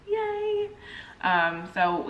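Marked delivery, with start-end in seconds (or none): 0.1-0.7 little cheer. Real, not acted. Cheer at Christmas plan.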